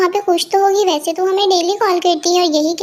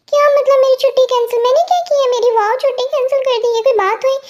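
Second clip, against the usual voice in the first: higher-pitched, startled objection; indignant protest; 5 semitones higher than usual. Acted. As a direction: protest the cancelled holiday in a raised pitch. Protests at holiday being cancelled. (हैरानी भरा विरोध, ऊँचे सुर में)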